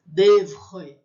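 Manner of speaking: loud "Devre" with a guttural French R; R felt in throat. Shown as a demonstration. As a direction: punch "Devre", scraping the R in the throat. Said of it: "ponho a mão no meu pescoço e sinto".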